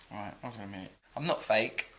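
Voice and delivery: ordinary talk, nothing marked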